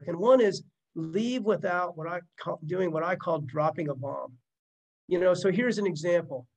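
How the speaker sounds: ordinary talk, nothing marked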